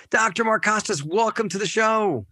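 big announcer-style welcome. Acted. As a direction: boom out the guest's name like an MC. Host's big guest welcome.

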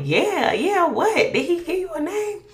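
high, loud, impatient yeah-yeah-what; impatient pushing. Retold or imitated. Wife badgering her husband for the baby's name, impatient. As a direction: push him for an answer, rudely. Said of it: "so I guess I was kinda mean".

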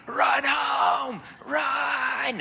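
high, loud shouts from the stands; high-pitched sideline scream, vowels stretched out; screaming parents. Acted. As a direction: yell it like frantic parents at a ballgame. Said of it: "the parents are screaming".

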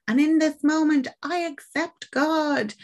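solemn declaration. Acted. Priest defying the devil, slow triumphant declaration. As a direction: proclaim faith with full conviction.

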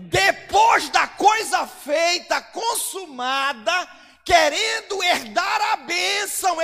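slow, shouted preaching; full-force preaching. Acted. Preacher reads the verse to the congregation, shouted slowly.

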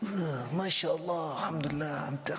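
hammed-up sluggishness after overeating; overstuffed groan. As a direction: act too full to speak, dragging it out. Acting out an overfull belly.

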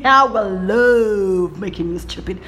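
normal talking voice

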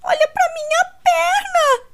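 slow, high, loud tearful cry; almost scream it through tears; near scream. A narrator's reading. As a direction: Kate yells at her sister in pain. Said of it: "quase gritando".

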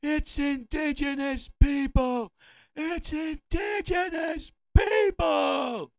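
high, slow mock bullhorn yell; mock screaming. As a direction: scream it like from a mountaintop. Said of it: "screaming, with a bullhorn".

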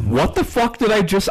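retold exasperation, voice jumping high; exasperated outburst. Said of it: "I think I literally just said".

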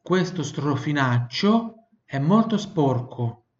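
slow, careful Italian sentence; slow model sentence. Shown as a demonstration. Teacher reads a slow Italian model sentence to repeat. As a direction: say it slowly for the student to repeat.

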